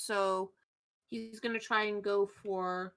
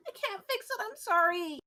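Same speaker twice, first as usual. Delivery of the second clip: very high, tearful little voice; hammed-up teary squeak; tearful apology; 12 semitones higher than usual. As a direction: apologize helplessly, pitched way up. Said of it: "you see a couple tears".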